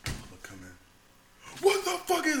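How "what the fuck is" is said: high, loud mock outrage; retold yelling. Superior yells in the watch-stander's face, retold yelling. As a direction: burst in yelling at a higher pitch. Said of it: "He just yelling in my face".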